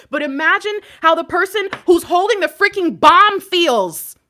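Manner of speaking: loud, high, impassioned wail of words; real emotional outburst; overwrought apology. Real, not acted. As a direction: throw each sorry out at full pitch.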